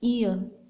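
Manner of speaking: ईयर with the final र silent, as "ee-uh"; native-speaker pronunciation. Shown as a demonstration. Teacher shows how a native speaker says ईयर, R left silent. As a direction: say ईयर without the R, gliding into a schwa. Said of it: "ऐसे ही एक native speaker इन्हें बोलता है".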